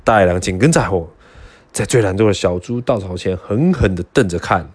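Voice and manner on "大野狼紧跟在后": plain reading voice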